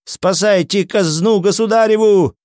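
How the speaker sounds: high-pitched alarm call; crying out. A narrator's reading. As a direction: raise the alarm to the servants. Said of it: "крича служителям".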